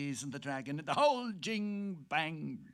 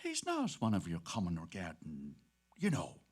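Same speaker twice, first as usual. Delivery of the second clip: serious; 8 semitones lower, 4 dB quieter than usual. Acted. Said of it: "he said seriously".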